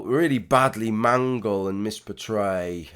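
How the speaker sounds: usual speaking voice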